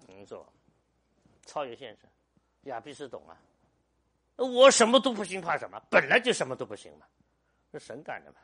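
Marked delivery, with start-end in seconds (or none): 4.4-7.1 shouted proclamation. Real, not acted. Pastor proclaiming total dependence on God.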